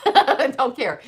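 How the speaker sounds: slow words through laughter; slowed, giggling defiance; laughing. Real, not acted. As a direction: laugh while drawing out the dismissal.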